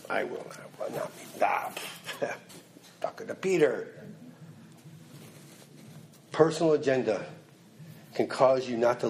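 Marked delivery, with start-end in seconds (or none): 0.1-4.1 breaks into a whispered laugh. Real, not acted. Preacher cracks up mid-quote, whispering. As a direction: let it dissolve into laughter.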